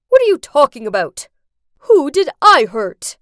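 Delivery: loud, indignant retort; angry denial. A narrator's reading. Dad answers daughter's accusation at wedding, angry denial. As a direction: fire back at the accusation.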